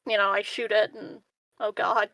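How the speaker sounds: ordinary talk, nothing marked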